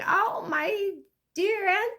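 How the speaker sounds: high-pitched, hammed-up fond reminiscence; gushing relative. Relative fondly remembering dear Aunt Sally, hammed-up gushing. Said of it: "They're gonna be like".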